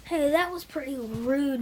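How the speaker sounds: ordinary talk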